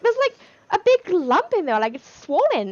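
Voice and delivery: high, loud imitated astonishment; mock alarm. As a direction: exclaim it as the startled husband. Retold husband alarmed by lump.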